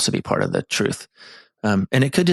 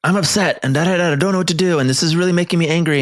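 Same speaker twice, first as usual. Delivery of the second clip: mock upset partner; 9 semitones higher, faster than usual. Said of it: "or you say".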